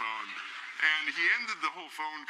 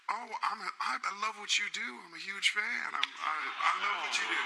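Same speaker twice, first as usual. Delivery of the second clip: high, gushing imitated voice; [name]; 9 semitones higher than usual. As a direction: imitate an admiring caller, pitched high. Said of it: "And he was like".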